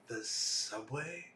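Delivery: slow, low, quiet questioning echo; imitated puzzlement. Locals quoted hearing a newcomer's word, mock puzzled. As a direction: draw the words out, rising as if baffled.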